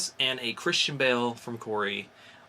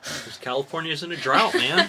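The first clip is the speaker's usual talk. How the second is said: laughing; 6 semitones higher, 5 dB louder than usual. Real, not acted. Joking answer, laughing.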